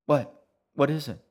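sudden loud, alarmed "What?"; jolted out of his focus, snapping to her; startled. Acted. Brother startled by her groan.

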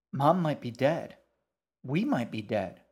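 put-on loud, emphatic girl, pitch jumping; frustrated. A narrator's reading. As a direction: hammer each statement out in frustration. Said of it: "She clenched her fists in frustration".

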